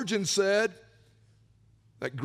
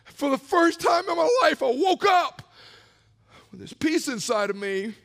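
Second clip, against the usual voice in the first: normal talking voice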